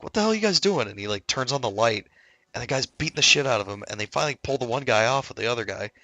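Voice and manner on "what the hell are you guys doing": high-pitched mock bewilderment; retold startled challenge. Woken soldier confronting two fighting guys, mock startled. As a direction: imitate someone baffled on waking.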